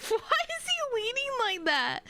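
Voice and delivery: very high, loud question; high-pitched bewilderment; baffled outburst. Real, not acted. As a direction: squawk it in disbelief. Baffled reaction to the game.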